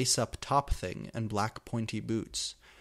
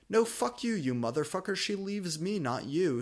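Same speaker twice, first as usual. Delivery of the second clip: much higher shouted retort; shrill shout; 8 semitones higher than usual. A narrator's reading. Vampire shouting back at Draco about the girl, shrill. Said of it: "shouted Vampire".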